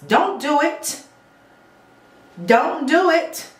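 DON'T DO IT, high and punched out; emphatic warning. Real, not acted. Lash tech warning clients off wet lashes, emphatic. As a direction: hammer home every word of "Don't do it", high-pitched.

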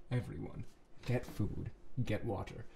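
ordinary delivery

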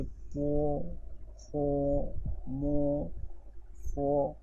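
slow drawn-out syllables po, pho, mo, pho; teacher modeling pinyin syllables; sound demo. Shown as a demonstration. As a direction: model each Chinese syllable "पो", "फो", "मो", "फो" slowly. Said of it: "हर sound के पीछे ओ क्या".

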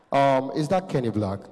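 low, thick-voiced tycoon; rich man. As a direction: ask in a deep rich-man voice. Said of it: "I heard the voice of a rich man".

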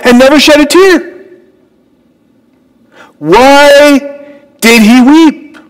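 "never shed a tear" and "Why did he weep?" pitched high with force; preaching emphasis. Acted. Preacher pressing the congregation at the sermon's climax, pitched high. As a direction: lift it up and press the question home.

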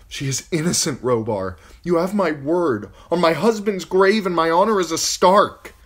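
high, loud desperate swearing; urgent plea. Acted. Catelyn loudly begging Robar to trust her, urgent. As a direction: beg him loudly on your honor.

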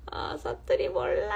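high, airy words through laughter; laughing. Real, not acted. Streamer laughing off the dialect request, giggling.

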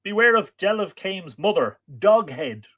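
high, loud narrated call. A narrator's reading. Woman warning Art as he leaves, calling out. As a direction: call the warning out across the water. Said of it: "she called".

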